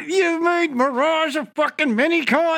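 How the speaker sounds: fan's exasperated shout; real outrage. Real, not acted. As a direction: protest in disbelief. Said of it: "outraged at the show".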